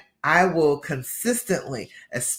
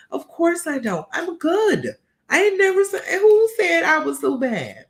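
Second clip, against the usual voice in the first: higher and louder, rising in defiance; indignant self-pep talk; 12 semitones higher, 6 dB louder than usual. Pretend. Talking back to her own brain, loud high indignation. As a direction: push back at your own doubts, getting higher.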